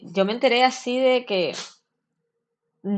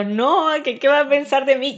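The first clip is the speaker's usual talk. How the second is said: raised, anxious retelling of her own panic; mock panic; 6 semitones higher, 4 dB louder than usual. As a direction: replay the fear of telling mom, a bit louder. Imita su miedo ante su madre. (susto imitado, más agudo)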